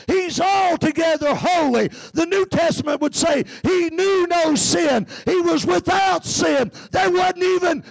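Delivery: high, shouted preaching that builds to a peak; full-force preaching. Acted. Preacher rousing the congregation about the coming King, full-force shouting. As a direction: preach it out at full force, calling over the crowd.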